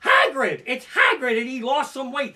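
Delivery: high, loud excited shouting of Hagrid; excited tourists. Retold or imitated. Tourists spotting him, calling out high and loud. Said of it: "They're like".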